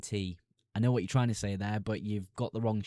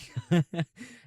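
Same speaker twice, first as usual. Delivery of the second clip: a loud, high burst of real laughter; 8 semitones higher, 6 dB louder than usual. Real, not acted. Laughing at his own remark to chat, high laugh. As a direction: crack up at your own line.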